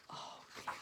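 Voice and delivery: gasp, then soft whispered oh dear; worried aside to the listeners. Acted. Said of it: "narrator reacts to danger".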